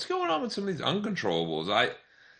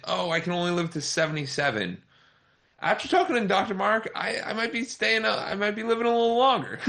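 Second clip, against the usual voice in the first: higher, hammed-up imitation of an everyday person; hopeful listener; 7 semitones higher than usual. Host imitates people hoping to live longer, in a higher mock voice. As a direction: play a surprised listener who now hopes to live longer. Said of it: "people think".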